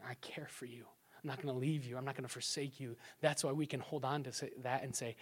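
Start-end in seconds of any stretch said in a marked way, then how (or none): 0.0-3.0 fast soft whisper, much softer; whispered reassurance. Retold or imitated. God reassuring his people, soft whisper. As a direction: whisper it like comfort in the ear. Voicing God's promise.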